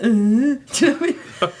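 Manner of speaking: ordinary talk, nothing marked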